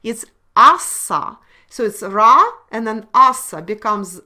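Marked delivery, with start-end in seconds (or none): 0.0-1.4 asa said slow and loud, stress on the A; slow loud corrective stress on asa; emphasized correction. Shown as a demonstration. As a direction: correct the student by hammering asa. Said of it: "but it actually not sa".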